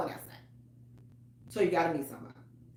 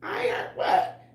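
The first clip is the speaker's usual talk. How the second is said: mock disbelief; slower than usual. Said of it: "I be like".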